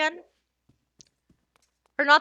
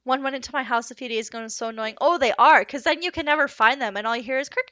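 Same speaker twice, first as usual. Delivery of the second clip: ordinary talk, nothing marked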